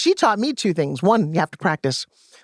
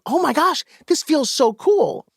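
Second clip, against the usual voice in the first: high, slow, delighted gasp at the cool towel; co-host's excited gush; 7 semitones higher, slower than usual. Retold or imitated. TV co-host reacting to the cooled towel, excited high gush. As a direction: ham up the wonder for the viewers. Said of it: "Then my co-host would go".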